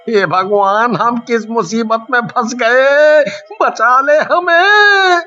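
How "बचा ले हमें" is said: high, drawn-out cry for help; wailing plea. Acted. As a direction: wail slowly to be saved. Thief begging God for rescue. (ऊँची, खिंची हुई रोती गुहार)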